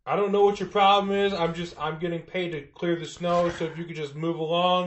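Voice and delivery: high-pitched, reasoning driver voice; snowplow driver. Acted. Plow driver asking resident to move along, high voice.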